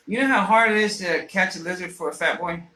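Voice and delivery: ordinary delivery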